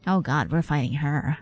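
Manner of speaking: ordinary delivery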